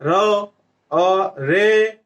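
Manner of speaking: "र", "अ", "रे" as separate drawn-out sounds; sounding out syllables. Shown as a demonstration. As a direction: stretch "अ" and "रे" slowly, one sound each. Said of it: "अ बोल रहे हैं". ("अ, रे" को खींचकर, अलग-अलग बोलकर)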